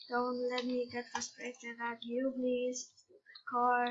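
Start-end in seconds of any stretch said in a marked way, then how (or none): none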